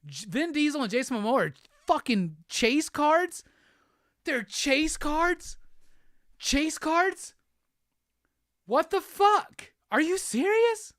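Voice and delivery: high, drawn-out disbelieving questions; can't believe the chase cards; incredulous outrage. Real, not acted. To the viewers, Vin and Momoa made chase cards, high incredulous cry.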